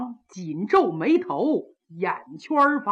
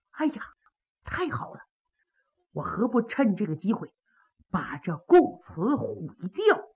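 much softer, scheming to himself; sly inner thought; 5 dB quieter than usual. Acted. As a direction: plot under your breath.

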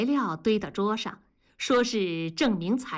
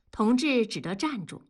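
ordinary delivery, nothing marked

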